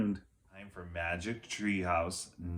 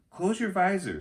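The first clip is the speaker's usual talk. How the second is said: put-on girl voice; 11 semitones higher, 4 dB louder than usual. Annie voiced.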